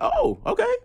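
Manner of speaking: high squeaky startled oh okay; a put-on stunned reaction; mock surprise. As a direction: act thrown off by the request. Retelling surprise at the photo.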